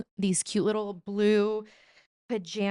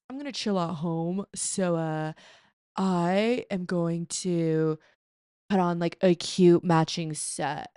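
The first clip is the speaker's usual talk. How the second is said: lightly voiced imagined man, slow and drawn out; a mild mocking guy voice, slowly; slow, lightly put-on bro voice; 2 semitones lower than usual. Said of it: "Sorry, I don't know why I'm talking like that".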